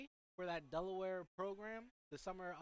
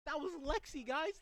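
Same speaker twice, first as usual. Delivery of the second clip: excited; 7 semitones higher, 5 dB louder than usual. Real, not acted. Excited about knowing the player.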